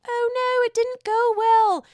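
high mock Whitney voice; squeaky high impression of a panicked castmate; imitating Whitney. Whitney calling Mary, high mocking voice. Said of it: "calls Mary and says".

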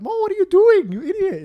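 very high, exasperated quote of a friend; mock scolding. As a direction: imitate someone calling out in exasperation. Friend calling from car, scolding.

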